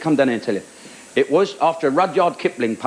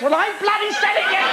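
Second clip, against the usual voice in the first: high, laughing complaint; exasperated protest breaking into laughter; laughing exasperation. Retold or imitated. Frustrated comic retort in story.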